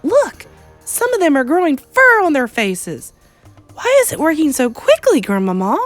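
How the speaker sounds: excited cry. Acted. Boy showing grandmother the changing witches, excited. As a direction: cry out in eager amazement. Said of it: "I cried".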